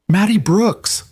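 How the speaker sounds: high, panicked shout across the market; panicked call. A narrator's reading. Berry vendor calls out for the missing girl, panicked. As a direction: call the lost child's name in panic. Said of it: "a woman's voice shrill with panic".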